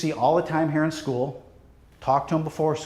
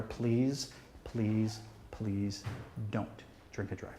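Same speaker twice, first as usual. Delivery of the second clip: hushed pleading that fades softer with each please; earnest plea; 6 semitones lower, 13 dB quieter, much slower than usual. Real, not acted. Speaker begging the students never to drink and drive, hushed fading plea. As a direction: beg them quietly, letting the voice sink almost to nothing.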